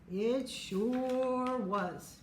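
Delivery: very slow, stretched repeat; drawn-out echo. A narrator's reading. Robbie agrees with relief, very slow drawn-out. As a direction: drag out each word.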